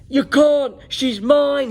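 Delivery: loud, high roaring claim; dragon roar. A narrator's reading. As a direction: roar it at the knight. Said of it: "he roared".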